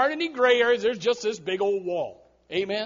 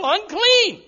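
much higher, louder mock yell; yelled warning; 9 semitones higher, 6 dB louder than usual. Preacher urging someone to warn the world, mock yell. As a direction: call the warning out boldly. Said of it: "Somebody better yell".

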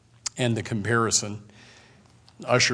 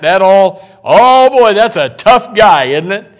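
much higher and louder, scornful sarcasm; sarcastic mockery; 8 semitones higher, 14 dB louder than usual. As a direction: sneer loudly at the 'tough guy'. Sarcasm about a defendant.

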